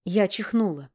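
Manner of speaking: plain reading voice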